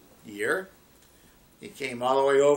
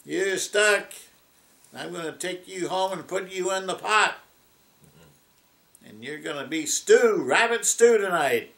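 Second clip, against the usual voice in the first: higher, louder triumphant taunting; gloating fox; 5 semitones higher, 5 dB louder than usual. Acted. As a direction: crow over the trapped rabbit. Fox catches stuck rabbit.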